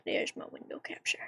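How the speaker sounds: whispered to self. Real, not acted. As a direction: breathe it to yourself. Muttering to self.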